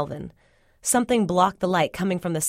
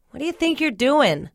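high, irritated demand; annoyed; 8 semitones higher, 3 dB louder than usual. A narrator's reading. Cyril catching the narrator in the cellar, annoyed. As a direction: snap in annoyance, pitched well up. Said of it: "Cyril sounded annoyed".

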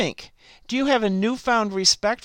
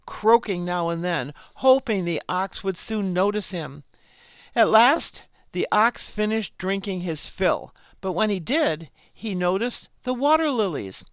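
ordinary delivery

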